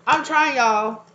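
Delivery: high and loud, pleading; laughing exclaim. Real, not acted. Reader to her viewers about not cussing, loud plea. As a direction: protest with a laugh. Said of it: "trying not to cuss".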